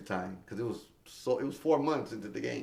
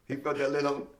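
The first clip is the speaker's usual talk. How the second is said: usual speaking voice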